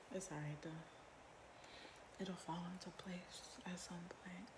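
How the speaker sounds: soft whispered aside; a murmured aside to herself. Real, not acted. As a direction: breathe it out under the breath. Whispered aside about her hair.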